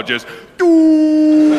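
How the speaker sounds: high, loud sung sci-fi blast; mock Star Wars sound; imitated Death Star blast. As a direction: sing the doom cue loudly.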